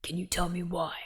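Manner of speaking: hushed whisper. Pretend. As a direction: whisper it low and secret. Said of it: "We could do Batman style".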